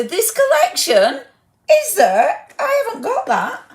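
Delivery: squealing pretend heartbreak over a missing mould; mock dismay. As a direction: play it like a crushed child, half joking. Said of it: "I'm going to cry in my soup".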